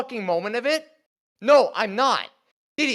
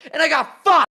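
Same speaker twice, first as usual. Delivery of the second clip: sudden loud, higher outburst; angry shout; 6 semitones higher, 6 dB louder than usual. Real, not acted. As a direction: blurt it out in real anger. Hurt host erupts in argument.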